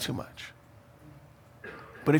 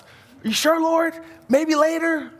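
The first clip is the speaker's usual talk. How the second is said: high, wheedling plea to the Lord; mock pleading believer; 10 semitones higher, slower than usual. Preacher mimicking a believer who bargains with God, high and wheedling. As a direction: whine like someone bargaining with God.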